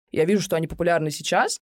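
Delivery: ordinary talk, nothing marked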